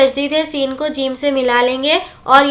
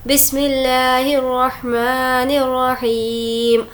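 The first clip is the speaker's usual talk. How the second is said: slow Quranic recitation; slower than usual. Shown as a demonstration. Teacher recites Quran opening.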